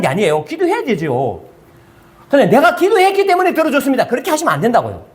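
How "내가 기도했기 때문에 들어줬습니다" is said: much higher, self-satisfied claim; proud pray-er. Retold or imitated. Preacher mimics a believer boasting that prayer earned the answer, higher mock claim. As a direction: put on the voice of someone crediting their own prayer.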